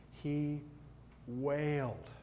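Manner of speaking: ordinary delivery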